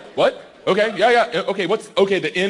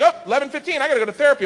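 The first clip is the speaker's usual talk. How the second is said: higher, breezy, casual announcement; mock wife voice; 10 semitones higher, faster than usual. Wife leaving for therapy, breezy higher voice. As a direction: lightly play the unsuspecting wife.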